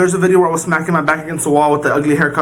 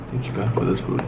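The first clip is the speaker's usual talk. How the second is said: softer, lower prayer; soft quiet grace; 9 semitones lower, 9 dB quieter than usual. Real, not acted. Thanking God for the food, quiet murmur. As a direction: murmur thanks before eating. Grace before second bite.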